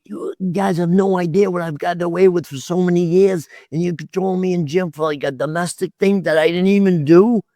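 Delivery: high indignant retelling; retold outraged protest. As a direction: imitate pleading outrage at the cops. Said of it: "I'm like".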